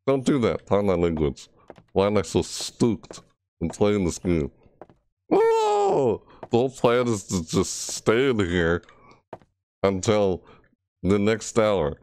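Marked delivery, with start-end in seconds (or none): 5.3-6.2 startled cry. Real, not acted. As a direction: yelp with sudden fright. Sudden scare in horror game.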